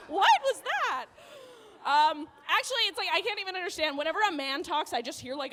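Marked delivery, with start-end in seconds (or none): none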